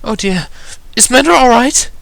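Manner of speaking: very high, concerned feminine figure; high voice, possibly tearful; slightly off Rarity. A narrator's reading. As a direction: ask anxiously in a high, upset voice. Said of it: "It sounded like Rarity, but was a little off".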